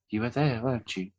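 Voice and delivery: soft whisper behind a wall; whispering through a door. Retold or imitated. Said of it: "I said".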